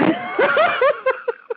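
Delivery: real laugh, high-pitched. Real, not acted. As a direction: laugh despite being mad. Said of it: "I know I'm laughing".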